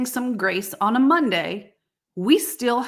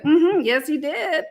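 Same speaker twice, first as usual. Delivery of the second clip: smug knowing reply; 7 semitones higher than usual. Retold or imitated. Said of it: "your brain's like".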